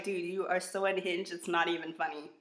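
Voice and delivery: ordinary talk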